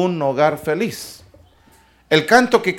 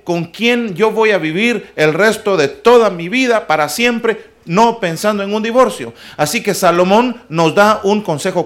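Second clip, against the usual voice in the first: put-on louder, full-force preaching to the young people; fervent preaching; 2 semitones higher, 5 dB louder than usual. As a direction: preach it out with conviction, loud. Preacher raises voice to youth.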